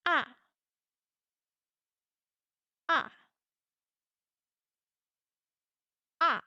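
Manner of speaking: short "Ah" from high, dropping fast; falling tone. Shown as a demonstration. Teacher drilling fourth tone, quick falling "Ah". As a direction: drop "Ah" quickly and firmly. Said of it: "go down quickly and strongly".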